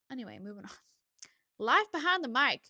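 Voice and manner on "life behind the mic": playful sign-off. Real, not acted.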